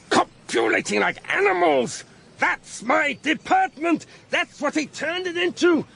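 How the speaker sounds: high, emphatic outburst building to a cry; indignant tirade, pitch climbing; outraged rant. Acted. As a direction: build in disgust to the last word. Ranting about his ruined department.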